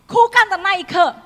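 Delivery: sudden loud exclamation; dramatic outburst. Real, not acted. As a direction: burst out at the moment it withers. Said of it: "preacher exclaims dramatically".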